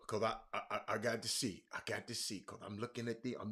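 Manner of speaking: low, quiet muttering while checking; low distracted mumble; muttering to self. Real, not acted. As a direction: talk softly to yourself, half-distracted. Checking devices, talking to self.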